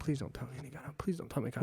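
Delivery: fast, desperate whisper; whispered plea. Retold or imitated. Man in traffic begging his body not to betray him, whispered plea. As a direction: whisper it quickly, begging under your breath. Pleading with own gut in traffic.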